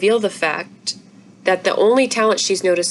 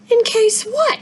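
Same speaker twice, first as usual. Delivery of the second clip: put-on young girl voice; slower than usual. Izzy's indignant retort.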